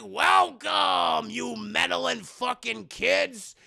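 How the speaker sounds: loud, slow gloating villain; villain greeting. Retold or imitated. Mister Spuds welcoming the heroes to the arena, slow gloating shout. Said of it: "And Mister Spuds is like".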